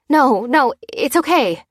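high, anxious wavering reassurance; quavering. A narrator's reading. Lucy trying to reassure Dan, quavering. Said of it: "quavered Lucy".

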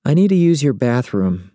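put-on a just-woken young woman; sleepy woman voice. Ramona voiced.

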